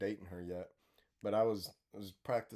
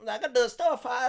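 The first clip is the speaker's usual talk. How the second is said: shouty Vietnamese seller parody, pitched way up; Vietnamese vendor accent; 13 semitones higher, 10 dB louder than usual. Retold or imitated. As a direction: call a customer over in a hammed-up Vietnamese accent. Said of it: "and they're like".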